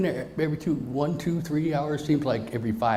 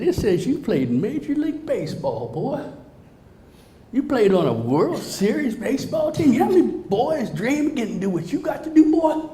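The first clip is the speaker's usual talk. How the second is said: scolding nurse; 11 semitones higher, 3 dB louder than usual. Retold or imitated. Nurse scolds patient, voiced.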